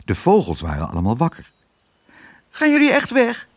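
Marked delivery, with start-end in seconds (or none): 2.5-3.5 high, piping little bird; thrush voice. A narrator's reading. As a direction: ask in a tiny high bird voice. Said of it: "vroeg de lijster Tiet". (hoog lijsterstemmetje)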